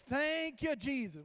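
retold shout of thanks, high and loud; exuberant praise shout. Friend praying aloud in a restaurant, shouted praise. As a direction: burst out loud, then ease off on the name.

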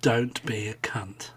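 slow unvoiced whispered insult. Real, not acted. Whispering an insult at friends who tease. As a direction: hiss it under the breath, slow. Mock-hostile retort after teasing.

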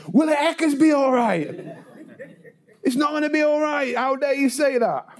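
high-pitched mock outrage; indignant retort. Worried man answering glib comfort, indignant. Acted retort to being told it's fine.

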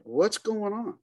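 high-pitched puzzled question; retold bafflement. Guide finding no blood on the tracks, baffled.

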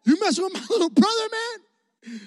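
high, fast mock-threatening challenge; tough challenge. Big brother confronting Travis at the balcony, mock tough. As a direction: square up to the bully. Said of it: "He goes".

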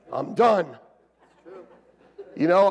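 usual speaking voice

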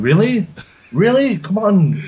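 high, incredulous "Really? Really?"; mock outrage that anyone doubts his accent; mock disbelief. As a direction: play the scoff, pitch shooting up. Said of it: "I'm thinking".

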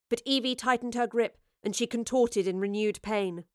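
ordinary delivery

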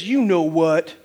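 low, knowing taunt; dry echo of a punchline; deadpan devil. Acted. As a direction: drop low for the devil's line. Devil echoing the parrot's punchline.

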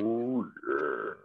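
deep, drawn-out mascot catchphrase; Kool-Aid Man. Shown as a demonstration. Showing a friend his party trick, deep mascot impression. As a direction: drop low and lengthen both words. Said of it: "I could do the Kool-Aid Man too".

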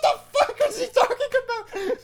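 high, loud, cracking up; laughing bewilderment. Retold or imitated. Tourists baffled by garbled tour guide, laughing hard. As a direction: barely get the question out for laughing.

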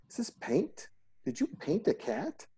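high, outraged squeal of disbelief; incredulous mock character. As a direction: play a shocked, prissy accuser. Mocking a movie character's line.

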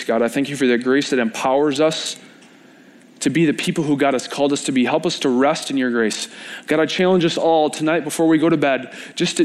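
earnest congregational prayer, building toward the middle; closing prayer. Acted. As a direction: pray aloud for the whole room, sincere and rising.

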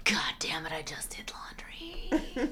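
exasperated whisper. Retold or imitated. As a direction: curse it under your breath. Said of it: "And you're like".

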